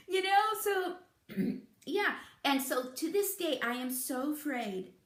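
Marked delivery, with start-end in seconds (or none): none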